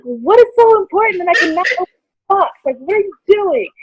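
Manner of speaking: loud, high incredulous questioning; exasperated quote, hammed up a little; retold exasperation. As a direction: act out impatient disbelief, pitched right up. Acting out her exasperated questioning.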